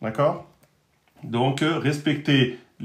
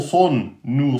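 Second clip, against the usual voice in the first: usual speaking voice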